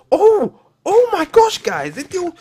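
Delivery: high, loud startled cry; startled shriek. Real, not acted. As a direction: yelp in sudden alarm. Startled by the monster.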